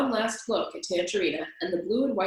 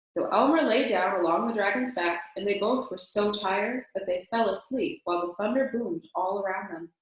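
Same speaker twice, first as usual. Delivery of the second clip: ordinary delivery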